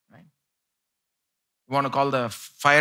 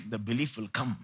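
ordinary delivery

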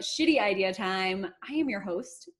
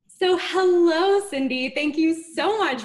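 big drawn-out hello, much higher and louder; slow, beaming welcome, much louder; enthusiastic greeting; 7 semitones higher, 10 dB louder than usual. Real, not acted. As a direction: greet the guest like a long-lost friend. Host greets guest enthusiastically.

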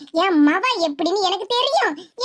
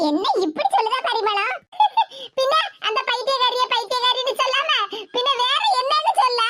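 ordinary talk

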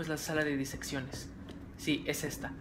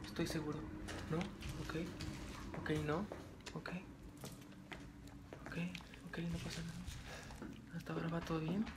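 tense hushed voice; 12 dB quieter than usual. Real, not acted. Tense creeping in horror game. (en voz baja y nerviosa)

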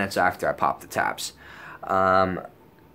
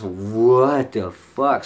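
very slow, loud, stretched-out disbelief; mock dazed slow-motion outburst; drugged bewilderment; 5 dB louder, much slower than usual. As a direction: drag each word out, dumbfounded.

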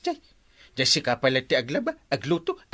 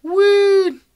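very high, loud shout; excited boast; 12 dB louder than usual. Acted. Uncle bragging to Kwarog, loud and high. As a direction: bellow it proudly.

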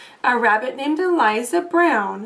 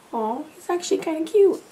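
fond aside; 3 semitones higher, faster than usual. Real, not acted. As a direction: melt a little at how cute he is. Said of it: "aside to children".

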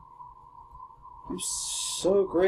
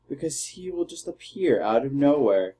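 ordinary delivery

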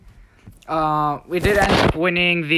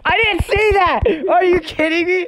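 high loud indignant cry; outraged disbelief; 13 semitones higher, 4 dB louder than usual. Real, not acted. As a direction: protest in sheer disbelief. Disbelief at opponent's advantage.

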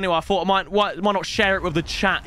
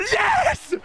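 ecstatic whoop. Real, not acted. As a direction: scream with laughing delight at the pull. Celebrating pack.

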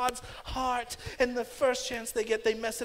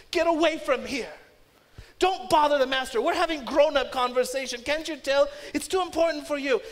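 louder, higher scolding bark; disciples rebuking; 4 semitones higher, 6 dB louder than usual. Retold or imitated. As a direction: shoo the kids away sharply. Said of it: "They rebuke the children".

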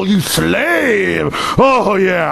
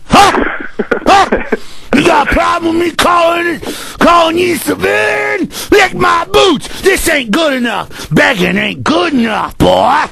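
imitating bullying cop. Radio host imitating a bullying cop for callers, shouted sneer. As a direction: bark it like a power-drunk cop lording over citizens. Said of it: "that's an imitation of 'em".

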